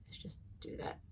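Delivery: soft unvoiced whisper. Real, not acted. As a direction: breathe it out under your breath. Whispering while measuring resin.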